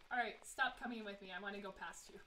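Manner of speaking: ordinary delivery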